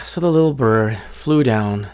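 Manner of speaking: plain reading voice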